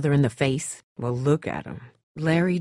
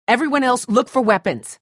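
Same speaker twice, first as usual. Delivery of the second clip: urgent order; 9 semitones higher, 6 dB louder than usual. Acted. Zombies breaking in, orders.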